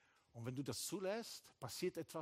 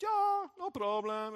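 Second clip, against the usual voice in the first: drawn-out, easygoing London voice, much higher and louder; relaxed Londoner; 12 semitones higher, 10 dB louder, slower than usual. Retold or imitated. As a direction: do the laid-back Londoner brushing it off. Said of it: "Er war ganz entspannter Londoner".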